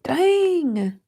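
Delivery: high, strained little cry of emotion; choked-up exclamation. Real, not acted. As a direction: let the feeling crack through one word. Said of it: "This word is just getting me".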